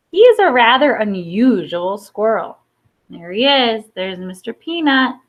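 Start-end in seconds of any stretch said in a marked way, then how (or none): none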